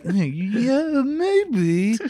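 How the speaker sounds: high, drawn-out hesitant yeah maybe; coy hesitant maybe. Retold or imitated. Straight guy tempted by the offer, high coy maybe. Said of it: "they're like".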